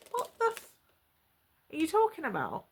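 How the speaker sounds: high-pitched, incredulous question; retold disbelief. Girlfriend of two years questions his confession, incredulous. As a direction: pitch it up in stunned disbelief, without shouting. Retells her shocked reply.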